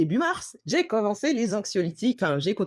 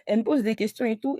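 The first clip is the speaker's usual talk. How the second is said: usual speaking voice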